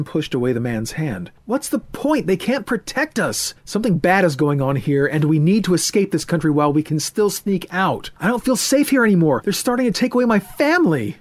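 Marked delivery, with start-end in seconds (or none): 1.5-11.2 put-on high, loud, distressed young man; upset young man. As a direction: pour it out in rising anger and fear. Voices Ethan, upset.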